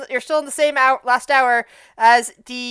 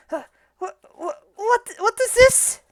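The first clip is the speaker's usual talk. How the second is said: startled; 9 semitones higher than usual. Acted. Tabaxi shopkeeper startled by genie.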